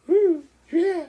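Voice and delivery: high, loud little cheer; playful cheer. Real, not acted. Dad delighting his baby, high playful cheer.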